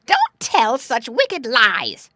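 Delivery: loud, high, drawn-out rebuke; angry scolding. A narrator's reading. Woman scolding the doggy, loud and angry. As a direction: hammer each word in a slow loud scold. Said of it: "scolded the woman".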